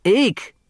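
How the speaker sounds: a short high squeak of alarm; small shriek. Acted. Sidel Pulaski shrieks on rereading her notes, small and high. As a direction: squeak it out in fright. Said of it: "uttered a small shriek".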